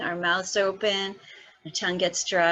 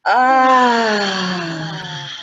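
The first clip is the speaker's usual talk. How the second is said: loud open exhale; loud sighing ah; 10 dB louder than usual. Shown as a demonstration. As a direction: let out a big sighing ah. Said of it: "side out".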